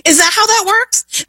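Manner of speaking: high-pitched, incredulous question; scoffing, high-voiced rhetorical question; sarcastic disbelief. Real, not acted. Mocks the other side's justification.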